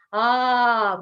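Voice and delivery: loud, sustained open vowel. Shown as a demonstration. Coach showing the kick sound, loud "ah". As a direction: call out a big open "ah". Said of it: "make the sound".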